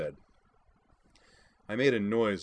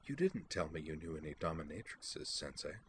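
hushed aside, much softer; low voice; 8 dB quieter than usual. A narrator's reading. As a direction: lean in and murmur it. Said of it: "in a low voice".

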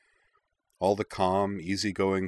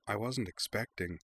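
subdued; 7 dB quieter than usual. A narrator's reading. Embarrassed after confession.

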